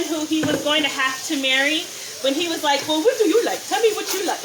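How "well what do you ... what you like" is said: badly faked African accent, pitched up; hammed-up film prince with a bad African accent; terrible African accent. Host quoting Coming to America, terrible African accent. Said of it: "It was a terrible African accent".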